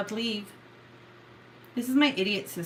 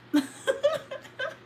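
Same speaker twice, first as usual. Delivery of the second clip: high-pitched burst of laughter; high laughing. Real, not acted. Laughing at silent sister, high giggle.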